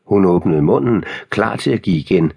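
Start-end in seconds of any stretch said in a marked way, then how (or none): none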